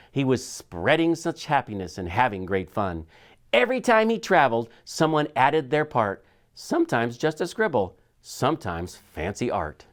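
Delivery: ordinary delivery, nothing marked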